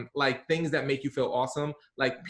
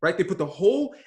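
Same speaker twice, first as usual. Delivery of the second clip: ordinary talk, nothing marked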